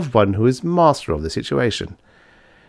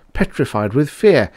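ordinary delivery, nothing marked

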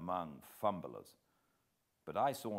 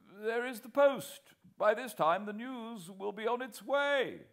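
higher, louder second man cutting in; Whitaker's voice; 6 dB louder than usual. A narrator's reading. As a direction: voice Whitaker, pressing the point. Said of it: "said Whitaker".

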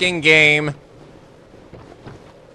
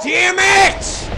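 very high strained yell; sudden burst of rage; furious scream; 3 dB louder than usual. Real, not acted. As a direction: scream the curse out. Rage at dying in game.

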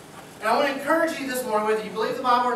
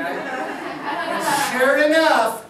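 drawn-out laughing; 5 semitones higher, 6 dB louder, much slower than usual. Real, not acted. Punchline told with laughing stretch.

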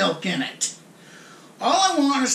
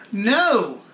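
ordinary talk, nothing marked

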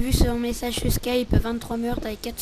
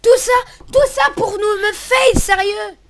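rising, outraged gamer complaint; indignant outburst; 5 semitones higher, 6 dB louder than usual. Real, not acted. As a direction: shriek at a teammate's betrayal. Teammate trapped him in game. (indigné et suraigu)

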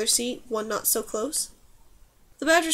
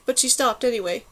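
usual speaking voice

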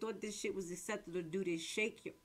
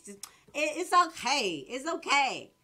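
higher and louder, slow on "Not comforting him"; real heated rant; 5 semitones higher, 7 dB louder than usual. Real, not acted. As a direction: hammer the point. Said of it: "I'm sorry, I'm a little loud".

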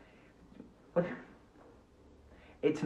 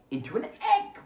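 ta-da reveal; 13 semitones higher than usual. Acted. Magic trick reveal.

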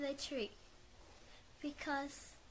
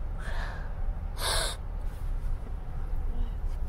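pained gasp; 6 semitones lower than usual. Real, not acted. Pain after tooth extraction.

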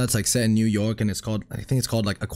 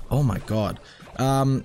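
ordinary talk, nothing marked

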